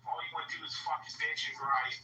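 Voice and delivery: imitating a host yelling at the room; retold angry rant. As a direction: fire it off like a host blowing up. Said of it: "and he's like".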